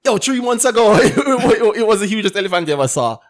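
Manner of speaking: high, loud, laughing exclamation; hammed-up, excited tall-tale voice; acted amazement. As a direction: marvel loudly through laughter. Rider exclaims over elephant's growth.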